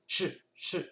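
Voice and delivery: Ch, ch: chuintement coupé net; stopped ch. Shown as a demonstration. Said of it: "On vient stopper". (ch sec, sans voyelle)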